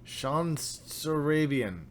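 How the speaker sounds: slow, halting stab at an unfamiliar name; stumbling slowly through a hard name; trying to pronounce it. Real, not acted. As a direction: sound out the name piece by piece. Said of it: "I'm trying".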